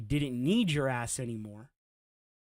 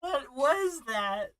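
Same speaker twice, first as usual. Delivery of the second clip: very high, slow, disbelieving; high incredulous; 10 semitones higher, much slower than usual. Real, not acted. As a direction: squeak it out in disbelief. Co-host asks again, very high.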